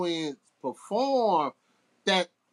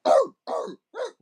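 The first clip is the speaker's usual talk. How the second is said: loud, higher-pitched mock barks; imitated dog bark; 13 semitones higher, 6 dB louder than usual. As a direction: bark out short bursts like a dog. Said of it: "barking like".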